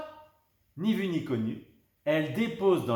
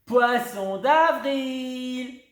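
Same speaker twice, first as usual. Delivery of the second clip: loud high, very slow; exploding with glee; triumphant cry; 11 semitones higher, 7 dB louder, much slower than usual. A narrator's reading. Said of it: "s'écrient les deux amies". (cri triomphal très lent)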